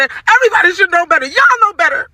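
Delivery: high-pitched, fast scolding; getting mad. Real, not acted. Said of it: "I'm getting so mad".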